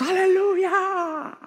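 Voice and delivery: aleluya agudo y estirado, exagerado; euforia fingida. Pretend. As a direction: estira el aleluya como en éxtasis. Imitando euforia espiritual. (aleluya exagerado y estirado)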